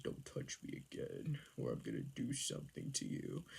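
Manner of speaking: soft, whispery mock menace; hushed threat. Man warning her after the tickling, hushed mock menace. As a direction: imitate a brooding man warning under the breath.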